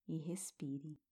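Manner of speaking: barely voiced breathing cue; very quiet. Shown as a demonstration. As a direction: drop almost to nothing. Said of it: "Observe a beleza da floresta".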